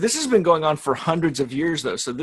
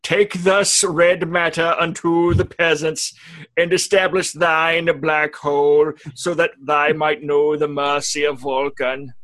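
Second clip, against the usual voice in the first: slow, louder solemn scripture voice; mock biblical prophet; 3 semitones higher, 5 dB louder, slower than usual. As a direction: proclaim it like an ancient prophet. Parody scripture recitation.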